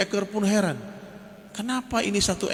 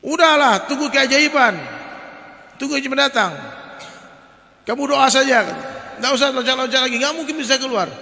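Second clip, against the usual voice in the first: high, loud scoffing advice; discouraging frogs; 7 semitones higher, 6 dB louder than usual. Retold or imitated. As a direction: talk the frog out of trying, mockingly. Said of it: "Digembosin, Pak, semangatnya". (kodok mengejek dengan nada tinggi)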